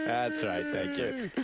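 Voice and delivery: put-on old man voice. Joins the old guy quote.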